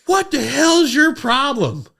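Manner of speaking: high, loud, slow mock yell; retold irate outburst; pissed-off trooper. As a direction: imitate an angry cop demanding answers. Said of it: "and he's pissed".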